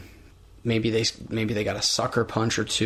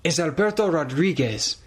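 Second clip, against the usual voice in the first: high, halting grand self-introduction; Del Rio impression; 5 semitones higher than usual. Host as Del Rio introducing himself, hammed-up. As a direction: announce the name like a showy wrestler. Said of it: "Do you wanna be A D R".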